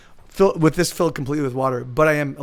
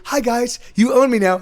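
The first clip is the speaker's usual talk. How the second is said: higher-pitched talking dog, lightly done; lightly voiced dog; 7 semitones higher, 4 dB louder than usual. Dog greets new owners, lightly voiced and higher. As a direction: give the dog a confident little voice. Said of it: "he walks up and he's like".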